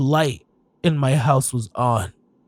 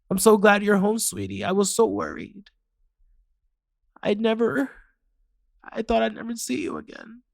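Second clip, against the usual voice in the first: relieved mother; 4 semitones higher, 5 dB quieter than usual. Acted. Mother greeting her lost child.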